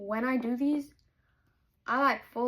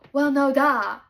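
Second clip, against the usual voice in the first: loud, mocking "duh"; loud sarcastic duh; 3 semitones higher, 11 dB louder, slower than usual. Real, not acted. Reader yelling "duh" at the book's heroine. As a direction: throw the "duh" at the book like it's obvious. Reacting to the book, louder.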